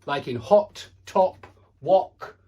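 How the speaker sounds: hot, top, wok with a short rounded o; short O vowel. Shown as a demonstration. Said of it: "this is a short O".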